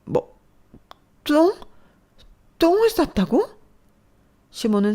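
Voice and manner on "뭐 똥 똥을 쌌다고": much higher, loud disbelief; mother stunned by an embarrassing confession; shocked shriek. Acted. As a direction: squeal in horrified disbelief. Mother hears shocking detail.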